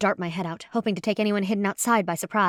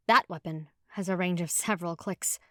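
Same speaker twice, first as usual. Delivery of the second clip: plain reading voice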